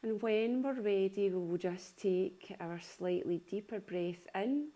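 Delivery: unhurried yoga nidra voice, long pauses between phrases; calm body-scan delivery, spaced out phrase by phrase; guided meditation. Acted. Leads a guided relaxation.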